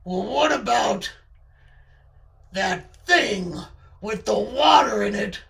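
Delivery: accusing character voice, building loud; Charlie's menacing voice. Acted. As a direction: press the grievance with mock menace. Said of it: "that voice does sound menacing".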